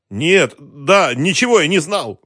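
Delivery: high, loud, panicky protest; flustered denial. A narrator's reading. Captive caught out by his interrogator, flustered and high. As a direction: stumble into a high, desperate denial.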